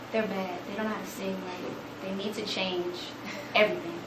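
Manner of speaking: very soft, grave verdict; grave disapproval. Retold or imitated. Boss quietly condemning students' singing to their teacher, grave.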